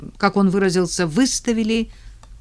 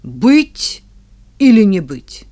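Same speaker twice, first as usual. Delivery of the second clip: mock dramatic; 7 dB louder, slower than usual. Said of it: "я вхожу и спрашиваю".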